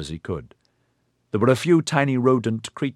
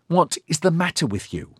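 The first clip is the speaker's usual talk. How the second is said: higher, cutting and contemptuous; scathing; 9 semitones higher than usual. Acted. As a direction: sneer it down at him. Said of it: "His mental tone was scathing".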